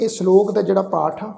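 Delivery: ordinary talk, nothing marked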